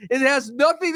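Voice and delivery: usual speaking voice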